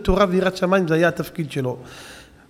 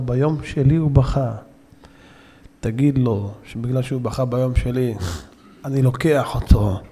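grave decree; 7 semitones lower than usual. Retold or imitated. Rashbi's grave pronouncement.

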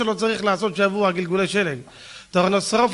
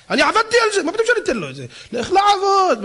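high mock-outraged miser; whiny protest: I worked for this; stingy man's indignant refusal; 11 semitones higher, faster than usual. Mimicking a stingy man refusing. (חיקוי קמצן מתלונן בקול גבוה)

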